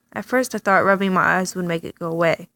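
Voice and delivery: usual speaking voice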